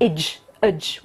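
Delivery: "Idge, udge" as short separate syllables; demonstrated endings. Shown as a demonstration. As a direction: sound out "Idge", then "udge", slowly. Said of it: "the most predictable pronunciation".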